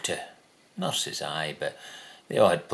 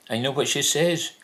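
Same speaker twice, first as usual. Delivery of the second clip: ordinary talk, nothing marked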